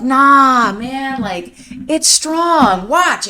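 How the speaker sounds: high, brash mock bravado; cocky brother. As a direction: show off to the scared brother. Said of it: "[name] is like".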